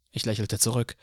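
ordinary delivery

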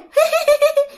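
very high-pitched cackle; high staccato spirit laugh; ghostly giggle. Acted. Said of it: "Lại cười tiếp".

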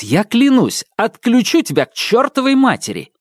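high, heated threat; angry threat. Acted. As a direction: swear you'll shut it off. Brother threatens robot.

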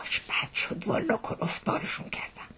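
whispered, rueful aside; barely voiced regret; hushed confession. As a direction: whisper it as a sheepish admission. Confides regret after scolding the men.

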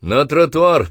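high, slow loudspeaker command; metallic megaphone voice. Acted. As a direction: boom it through a bullhorn. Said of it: "Из громкоговорителя металлический голос".

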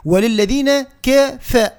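وللذين كف slowly, "ke" and "fe" cut apart; separate, light syllables on كف; broken into syllables. Shown as a demonstration. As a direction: recite وللذين كف piece by piece. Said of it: "نقطعها مقاطع".

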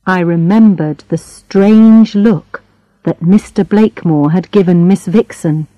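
usual speaking voice

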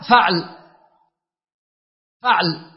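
فعل with short a after f, vowelless ʕ; a then silent middle. Shown as a demonstration. Teacher showing students the first noun pattern, "faʕl". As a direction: say فعل as "faʕl", one syllable. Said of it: "هذا بفتح الفاء ... وتسكين العين".

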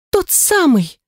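high excited shriek; delighted squeal. A narrator's reading. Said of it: "От восторга взвизгнула Лира".

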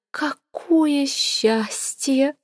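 theatrical breathless relief; breathed out. A narrator's reading. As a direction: sigh it out rapturously. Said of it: "выдохнула Сивилла".

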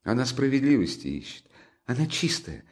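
high, pleading, near tears; anguished defence. A narrator's reading. Sonya passionately defends Katerina to Raskolnikov, high and pleading. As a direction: plead her case in anguish.

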